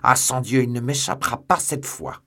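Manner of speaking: enraged shout, hardest on the opening oath; angry cry. A narrator's reading. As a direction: cry out in fury as you rush off. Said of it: "rougir de colère et s'élancer hors du cabinet en criant".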